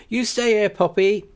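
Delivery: firm motherly command; Rose voiced, firm. Rose tells Poppy to stay in the cab, firmly.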